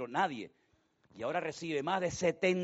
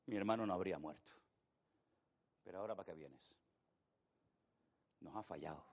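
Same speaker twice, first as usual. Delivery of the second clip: grieving sister; 7 semitones lower, 7 dB quieter than usual. Acted. Sisters lament Jesus came late.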